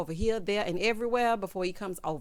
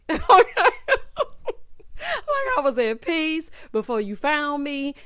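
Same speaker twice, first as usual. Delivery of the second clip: laughing; 7 semitones higher, 6 dB louder than usual. Real, not acted. Host laughs at her own rant.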